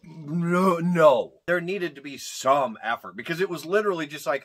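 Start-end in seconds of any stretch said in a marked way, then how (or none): none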